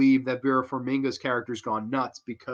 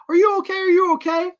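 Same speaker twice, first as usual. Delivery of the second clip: worried high voice; 5 dB louder, faster than usual. Retold or imitated. Nun checks on the girl.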